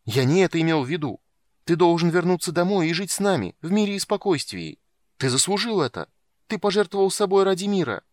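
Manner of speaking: loud, high pleading protest; pleading shout. A narrator's reading. Palin protests, shouting at uncle.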